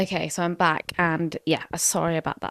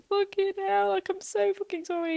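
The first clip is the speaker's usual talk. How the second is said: high, wailing mock sob of apology; over-the-top teary apology to girlfriend; mock dramatic crying; 13 semitones higher than usual. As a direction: ham up a tearful sorry. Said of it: "I'm crying dramatically".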